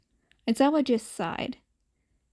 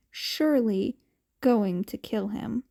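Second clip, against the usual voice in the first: ordinary delivery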